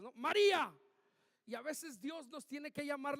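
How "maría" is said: "María" shouted much louder and higher; strong exclamation. Shown as a demonstration. Preacher demonstrating Jesus calling Mary with force, loud. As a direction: belt out "María" like a firm call. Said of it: "Signos de admiración son palabras fuertes".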